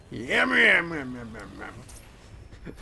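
drawn-out "yum-yum" drill whirr, dying away; imitated drill noise. Player showing off the drill move, imitated drill noise. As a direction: mimic a drill running down.

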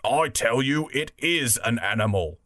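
lightly voiced insistent bulldog; a hint of a bulldog, insisting. Bulldog contradicting the other animals, lightly voiced bulldog. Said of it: "said the bulldog".

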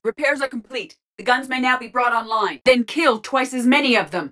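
high-pitched combat update; urgent battle report. Acted. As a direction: report it over a firefight. Urgent combat status lines.

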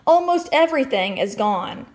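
usual speaking voice

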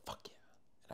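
very soft whispered celebration; hushed little victory cheer; whispered triumph. Retold or imitated. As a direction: whisper it privately, reenacting the moment. Retold private excitement in class.

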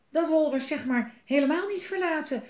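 high, loud, out of breath; breathless, rattled outburst; panting. A narrator's reading. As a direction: gasp it out after running. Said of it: "zei Bobba hijgend".

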